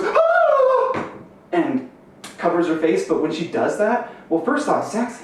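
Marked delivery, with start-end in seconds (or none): 0.0-1.1 high squeal of delight, much higher and louder; sudden thrilled squeal; excited shriek. Retold or imitated. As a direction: shriek like she just got proposed to. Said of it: "she just goes".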